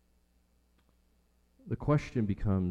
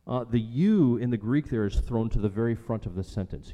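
ordinary delivery

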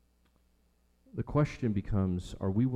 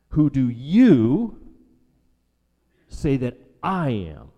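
YOU and I punched loud and drawn out; high, stretched YOU, pause, emphatic I; stress on YOU and I; 4 semitones higher, 10 dB louder, slower than usual. Shown as a demonstration. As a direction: slowly hammer "you", then "I". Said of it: "the emphasis here is".

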